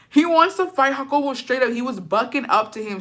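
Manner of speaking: ordinary talk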